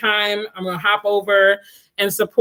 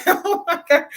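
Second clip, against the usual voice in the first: laughing; 8 semitones higher than usual. Real, not acted. Laughing in disbelief.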